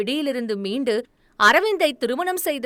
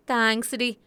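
weary. A narrator's reading. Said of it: "சலிப்பாக அவள் கூற".

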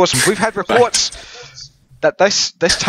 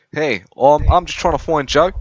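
put-on Australian accent. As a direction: play an Aussie official in an Australian accent.

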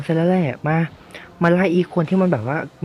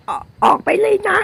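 very high put-on villager voice; ชาวบ้านขับไล่. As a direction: taunt the uncle in a squeaky, high falsetto. (เสียงดัดแหลมสูง เหน็บแนมไล่ลุง)

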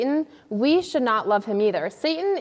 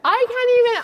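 high exasperated squeal; 12 semitones higher, 5 dB louder, faster than usual. Pretend. Mock outrage continues.

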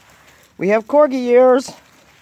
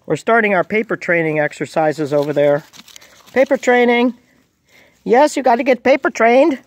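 ordinary talk, nothing marked

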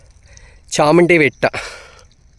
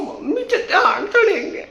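laughing. Real, not acted. Laughing while dog plays.

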